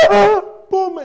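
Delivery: high, loud mock wail; mock self-pity. Preacher mimicking someone crying for himself, mock self-pity. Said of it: "There's a difference between self-pity".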